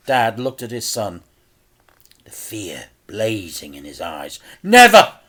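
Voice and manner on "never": loud, high "Never!"; a furious refusal; shouted. A narrator's reading. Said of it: "shouted Frank".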